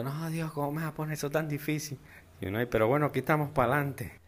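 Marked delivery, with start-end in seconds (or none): 0.0-2.0 higher, softer, hurried whine; mock whining plea. Rich kids whining to God about their task, higher and softer. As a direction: whimper a complaint to God like a spoiled kid. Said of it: "Y los bichos ahí llorando".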